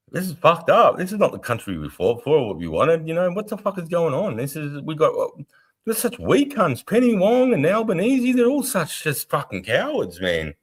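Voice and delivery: high, heated rant at the government; angry rant. Retold or imitated. Ranting to stream viewers about politicians, high and heated. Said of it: "I'm like".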